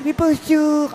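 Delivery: squeaky high-pitched, loud put-on hello; silly greeting voice. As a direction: chirp it like a cartoon character. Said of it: "puis il fait".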